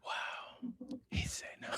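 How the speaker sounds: whispering back her words, mockingly. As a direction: echo it in a breathy stage whisper. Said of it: "this girl's so performative".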